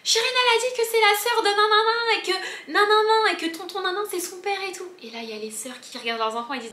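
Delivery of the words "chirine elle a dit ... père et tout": high, loud, singsong tattling; excited kids shouting. Retold or imitated. Children run to their mother, high singsong shouting.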